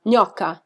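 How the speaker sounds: "Gnocca." with the gn said as ny, stress on the first syllable; Italian gnocca. Shown as a demonstration. Explaining the Italian slang word, saying "Gnocca." the Italian way. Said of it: "if you say someone is a gnocca".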